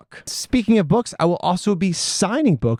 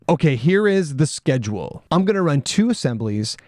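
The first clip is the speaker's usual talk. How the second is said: usual speaking voice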